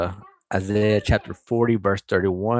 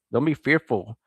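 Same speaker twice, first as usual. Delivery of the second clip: ordinary talk, nothing marked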